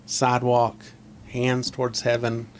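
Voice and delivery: ordinary delivery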